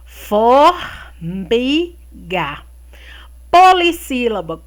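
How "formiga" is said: FOR... MI... GA, slowly, with gaps between syllables; "Formiga" slowly chopped into for, mi, ga; split into syllables. Shown as a demonstration. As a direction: sound out Formiga in three separate beats. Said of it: "três sílabas".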